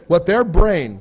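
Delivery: normal talking voice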